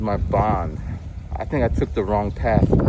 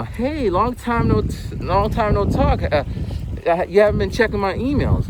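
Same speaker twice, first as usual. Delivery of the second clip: fake-friendly bot; 8 semitones higher than usual. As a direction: drag it out like a phony old pal. Mimicking the spam bot's friendly email.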